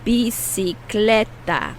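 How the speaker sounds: "Bicicleta" said slowly, every syllable spaced; slow model word. Shown as a demonstration. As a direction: draw out "Bicicleta" for learners to copy. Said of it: "How do you say bicycle in Spanish?".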